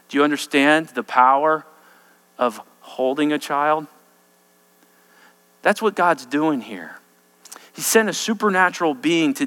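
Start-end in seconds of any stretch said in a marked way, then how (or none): none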